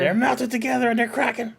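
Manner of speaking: high, loud mock despair; dramatic dismay. Real, not acted. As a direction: wail over the broken snack. Dismay at crushed snack.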